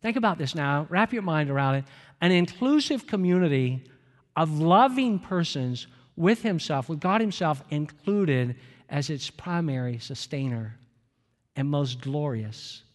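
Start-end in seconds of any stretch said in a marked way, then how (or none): 2.2-12.8 quote reading. Acted. Reads Willard quote slowly, fading.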